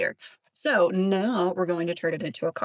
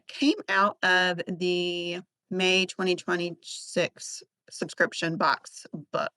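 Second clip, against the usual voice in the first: usual speaking voice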